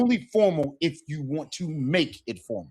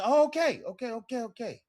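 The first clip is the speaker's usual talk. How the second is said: backing off gently; understanding listener; 8 semitones higher than usual. Retold or imitated. The listener backing off after un poco, high then fading. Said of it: "They say".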